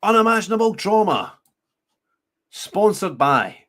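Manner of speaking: loud, high mock sponsor read; mock announcer. Commentator turning the court's phrase into a sponsorship, mock announcer. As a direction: blare it out like a show's sponsor.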